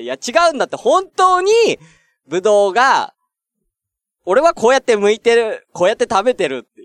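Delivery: pleading his case, mildly exaggerated; flustered protest. Retold defending himself protest. (必死に言い訳する高めの声)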